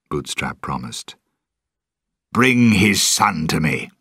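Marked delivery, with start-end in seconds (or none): 2.3-3.9 order. Acted. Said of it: "Jones ordered".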